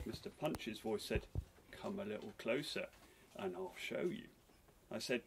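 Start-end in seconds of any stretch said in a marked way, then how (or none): 1.7-4.3 softer, low coaxing; hushed, inviting puppet voice; sly invitation. A narrator's reading. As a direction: lure them in quietly. Puppet lures the woman closer.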